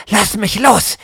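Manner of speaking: loud, high angry hiss. Acted. Freed woman pushes narrator away, loud angry hiss. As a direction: spit the words at him. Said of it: "zischt sie".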